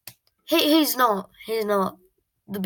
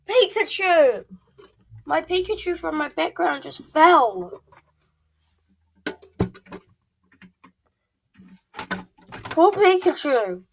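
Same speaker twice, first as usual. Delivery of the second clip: high, loud mock grief; hammed-up sorrow over Pikachu; mock distress; 7 semitones higher, 4 dB louder than usual. Real, not acted. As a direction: lament the fallen toy dramatically. Mourning fallen toy.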